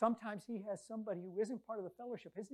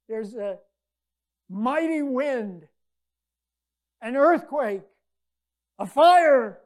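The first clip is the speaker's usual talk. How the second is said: each image louder than the last, very slow at first; building to booming; 4 semitones higher, 20 dB louder, much slower than usual. Acted. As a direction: swell up like a rising storm. Building images of God's power.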